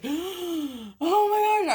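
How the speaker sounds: high, slow, choked-up oh my gosh; half-sobbing with joy after her exam; tearful gasp. Retold or imitated. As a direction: let the tears catch the words as you stretch them out. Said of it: "I was crying".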